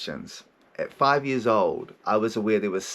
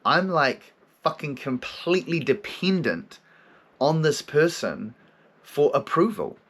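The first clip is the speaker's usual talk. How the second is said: slow dawning realisation; 3 semitones higher, slower than usual. Retold or imitated. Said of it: "when you're like".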